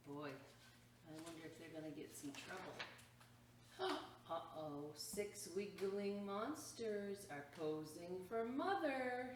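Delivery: ordinary talk, nothing marked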